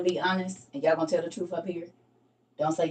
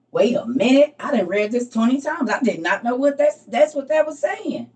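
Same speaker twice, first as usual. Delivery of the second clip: loud, higher, amazed reader finally getting it; amazed realization; 5 semitones higher, 7 dB louder than usual. Retold or imitated. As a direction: burst out like the penny just dropped. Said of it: "Oh, hell".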